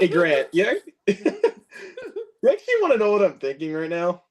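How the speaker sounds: talking through real laughter; laughing. Real, not acted. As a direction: giggles breaking up the question. Still laughing at the film quotes.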